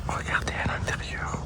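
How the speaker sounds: hushed, barely voiced; whispering. Real, not acted. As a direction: whisper it so nobody hears. Showing a quiet interior.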